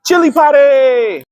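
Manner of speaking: high, slow shouted "Chili padi!"; punchline shout. Shown as a demonstration. Vlogger yelling the pun to viewers, loud and high. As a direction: belt out "Chili padi!" as the joke's payoff. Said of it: "Get it?".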